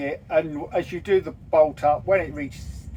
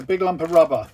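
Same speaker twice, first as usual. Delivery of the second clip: loud, fast explanation; shouting; 2 semitones higher, 7 dB louder, faster than usual. Real, not acted. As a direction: call it out loudly and quickly. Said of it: "You don't have to shout".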